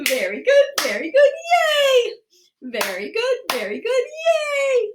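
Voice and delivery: bouncy cheer with a very high, stretched final word; celebratory cheer. Pretend. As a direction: chant it, then shoot up into a squealed yay. Group cheer closing laughter exercise.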